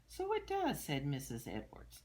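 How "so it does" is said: very high, amused teacher voice; delighted teacher. Acted. Teacher agreeing Amelia's name rhymes, very high and amused. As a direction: squeal it with delight.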